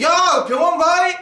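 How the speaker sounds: high, loud, careless call-out; mock offhand call. Preacher mimics careless words to the dying, mock offhand call.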